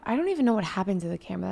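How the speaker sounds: ordinary delivery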